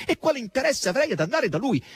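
high-pitched indignant question. A narrator's reading. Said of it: "a voce così alta".

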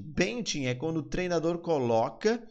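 ordinary talk, nothing marked

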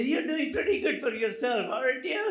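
loud, high, laughing tease; teasing. Retold or imitated. Doctor teasing old patient, loud playful ribbing. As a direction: rib him about his date.

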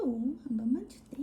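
soft whispered plea. Real, not acted. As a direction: whisper it gently. Whispered repeat.

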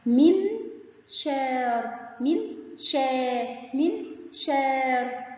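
"منشار" very slow, aa stretched out; long aa. Shown as a demonstration. Teacher sounding out a word for pupils, "منشار" with long aa. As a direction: draw out the "شا" inside "منشار". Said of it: "مد بالألف مع الشين".

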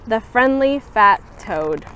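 ordinary delivery, nothing marked

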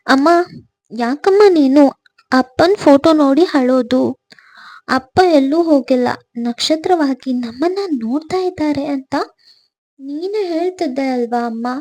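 put-on a child's loud, earnest plea to his mother; Vikas. A narrator's reading. Son reminding his mother that father watches as a star, loud child's plea. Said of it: "ಎಂದು ಕೇಳಿದ ನನ್ನ ಮುದ್ದಿನ ಮಗ".

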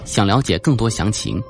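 usual speaking voice

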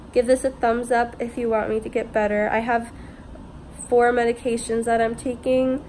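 normal talking voice

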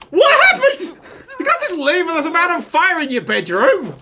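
high, loud, disbelieving yells breaking into laughter; shocked shout. Real, not acted. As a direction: yell in total shock at the screen, laughing. Sim suddenly on fire, bloody.

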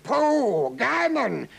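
loud, high scoffing outburst; disgusted. A narrator's reading. Count rejecting what he sees in the arena, disgusted. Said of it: "Count Hoginamo was disgusted".

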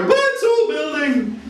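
high, slow, drawn-out panicked wail; hammed-up horror at a mere picture; mock terror.

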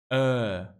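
"Eh" held as a long, low schwa. Shown as a demonstration. Teacher demonstrates the long schwa, drawn-out Eh. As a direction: stretch "Eh" from the throat. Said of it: "pero largo".

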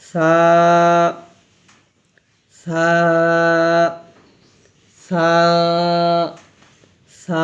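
"সা" with a very soft th-like s and long aa; soft sound. Shown as a demonstration. Teacher leading repeats, soft long "সা". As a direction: start "সা" softly and draw it out. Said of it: "একবার নরম আওয়াজ হবে".

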